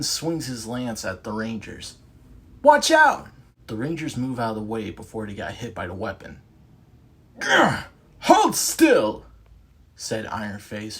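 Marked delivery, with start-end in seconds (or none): 2.6-3.3 loud, high warning cry; warning shout. Acted. 7.4-9.2 loud, high British snarl; frustrated British villain. Acted. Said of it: "who was frustrated".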